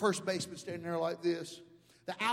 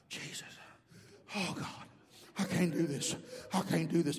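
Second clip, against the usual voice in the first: winded groaning. Acted. Preacher acting out his own struggle, winded and defeated. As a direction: groan it out as if you can't go on.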